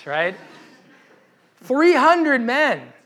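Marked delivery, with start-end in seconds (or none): 1.6-2.9 slow, loud, high punchline; dramatic reveal. Real, not acted. Preacher revealing Gideon's tiny army, loud slow emphasis. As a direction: land the absurd number slowly.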